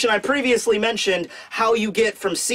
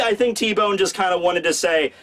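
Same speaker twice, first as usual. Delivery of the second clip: ordinary talk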